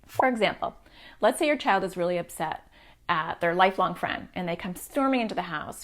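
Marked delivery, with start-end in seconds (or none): none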